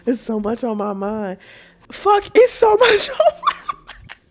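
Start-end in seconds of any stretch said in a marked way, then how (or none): 1.9-4.2 high, slow, giggling mock lament; laughing. Real, not acted. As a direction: laugh through the whine. Laughing through mocking line.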